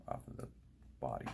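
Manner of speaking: normal talking voice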